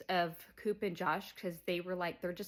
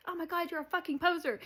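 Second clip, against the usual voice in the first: high and fast, much higher; exasperated outburst; 10 semitones higher, 3 dB louder, faster than usual. Retold or imitated. Cooper calling out Matt, high and fast. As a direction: blurt out the accusation. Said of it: "Cooper's like".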